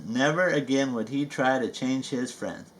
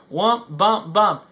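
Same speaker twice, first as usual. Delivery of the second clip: bouncy sound words; 6 semitones higher, 5 dB louder, slower than usual. Acted.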